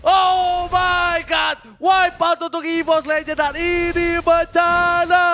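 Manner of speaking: loud stretched hype yelling; excited shouting. Real, not acted. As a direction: yell the wipe out. Caster yells wipe out.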